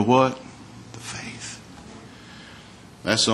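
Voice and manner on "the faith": breathy whisper of the answer; a hushed reveal to the congregation. Shown as a demonstration. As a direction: breathe the words out softly. Answer to his own question.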